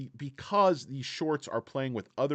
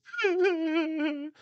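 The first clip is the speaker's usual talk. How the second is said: loud laugh; 5 dB louder than usual. Real, not acted. Laughing at own bit.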